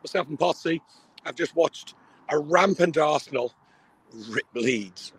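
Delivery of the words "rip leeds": said quietly. Real, not acted. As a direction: keep it down, lower and softer. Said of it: "I can't say it too loud".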